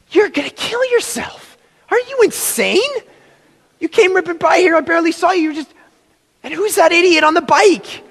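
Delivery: high worried mom scolding; frantic mom. Retold or imitated. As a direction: shriek it like a scared parent. Mom panicking at son.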